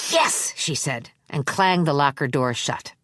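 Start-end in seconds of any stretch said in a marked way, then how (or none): 0.1-0.5 pleased yes. A narrator's reading. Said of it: "flushing with pleasure".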